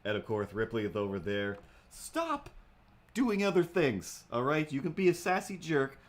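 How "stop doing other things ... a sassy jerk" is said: mock scolding. Real, not acted. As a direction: scold the cat playfully. Scolding the cat Ripley.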